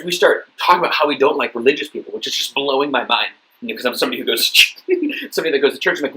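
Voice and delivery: normal talking voice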